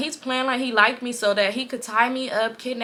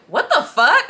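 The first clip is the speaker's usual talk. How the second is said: higher, louder startled question; imitated shock; 5 semitones higher, 5 dB louder, slower than usual. Man finding a girl on his bed, imitated shock. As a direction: echo his shock, pitched up.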